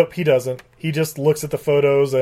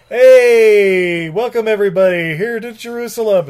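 loud, higher opening in a bad accent; hammy foreign-accented welcome; Nikolai's horrible accent; 5 semitones higher, 5 dB louder than usual. Nikolai opening the press conference, horrible put-on accent. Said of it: "Sorry, in his horrible accent".

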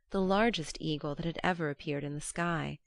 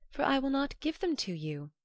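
much higher, firm refusal; defiant Iduna; 9 semitones higher than usual. A narrator's reading. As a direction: Iduna stands her ground, voiced high.

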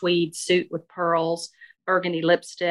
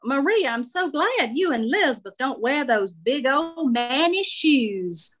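Southern lady voice; 7 semitones higher than usual. Acted. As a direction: savor the words like a gossipy matron. Shirley commenting on shoes.

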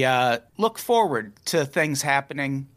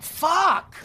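frustrated curse. Real, not acted. Botched intro, frustrated outburst.

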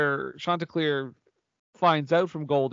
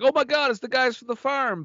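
surprised; 9 semitones higher than usual. Retold or imitated. Chanticleer reading the letter.

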